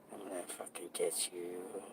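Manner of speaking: very soft, breathy menacing clown voice; a clown's soft menacing promise; creepy clown threat. Acted. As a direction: hiss the threat from behind the mask. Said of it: "Pretty good acting there".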